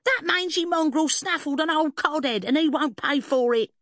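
high, loud cockney tirade; shrill-pitched, loud accusation; shrieking fishwife. A narrator's reading. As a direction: rail at him like an outraged fishwife.

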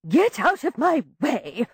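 slow, imperious order; haughty slow command. A narrator's reading. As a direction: bark the order down the stairs.

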